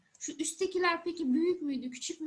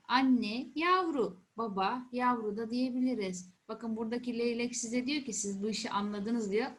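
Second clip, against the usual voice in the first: ordinary talk, nothing marked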